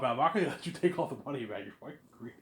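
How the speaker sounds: ordinary delivery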